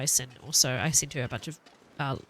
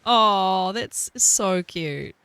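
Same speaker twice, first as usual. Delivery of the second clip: very slow, louder southern coo on so; southern drawl; 4 semitones higher, 7 dB louder, much slower than usual. Pretend. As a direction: savour the southern vowels. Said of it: "it just feels really nice to speak southern".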